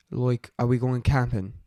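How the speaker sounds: loud attempt at an Australian accent; amateur Aussie accent. Shown as a demonstration. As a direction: try it in broad Australian. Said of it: "let's try Australian".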